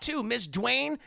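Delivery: ordinary talk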